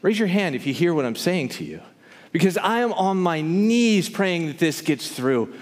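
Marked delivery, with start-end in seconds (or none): none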